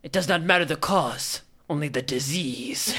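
grand, voguing couturier voice; dramatic designer. Acted. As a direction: pronounce it like a fashion verdict. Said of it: "He just talks very dramatically".

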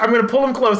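normal talking voice

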